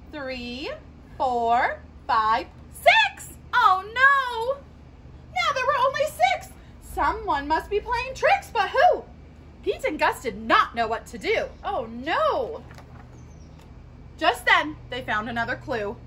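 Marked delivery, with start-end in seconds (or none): none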